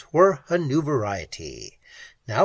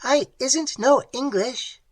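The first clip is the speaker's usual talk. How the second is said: much higher, shrill creature voice; shrill clicking; 11 semitones higher than usual. Acted. Creature correcting Pat, shrill clicking voice. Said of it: "The clicking voice sounded".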